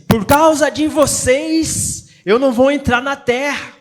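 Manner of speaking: Moses reproaching the people. Acted. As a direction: hold each word out as a rebuke. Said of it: "Moisés tá dizendo assim".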